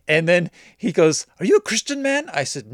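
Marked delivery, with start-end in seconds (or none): 1.4-2.3 much higher, searching appeal; pleading question. Retold or imitated. Buyer asking the seller about his faith, high pleading question. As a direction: lift it high like a moral challenge.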